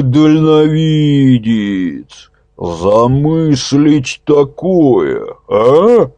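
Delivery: loud, gleeful, amazed praise. A narrator's reading. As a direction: marvel and gloat at once. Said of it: "изумлённо".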